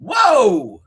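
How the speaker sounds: high, loud drawn-out whoa; hammed-up stunned reaction; mock astonishment. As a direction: exclaim in pretend amazement. Acts reaction to dye job.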